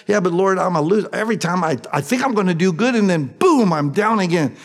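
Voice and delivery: a touch of self-pity, whining to the Lord; whiny sinner. Acted. Pleading sinner voiced in sermon.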